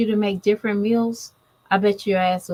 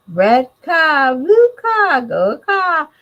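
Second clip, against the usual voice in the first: put-on high, loud, sing-song little kid voice; toddler talk; 7 semitones higher, 7 dB louder, much slower than usual. Mother imitates her toddler son's car words, high childlike chirp. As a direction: chirp it out like a toddler naming cars.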